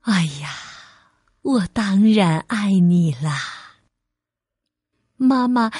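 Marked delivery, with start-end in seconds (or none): 0.0-3.4 low, tender reassurance; comforting mother. Acted. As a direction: soothe a crying child. Mother comforts crying child.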